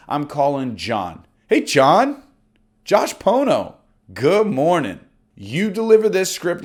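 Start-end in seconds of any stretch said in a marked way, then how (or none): 1.5-5.0 warm confident greeting. Shown as a demonstration. Agent pretending to call John, warm and confident. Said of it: "the script gets delivered like this".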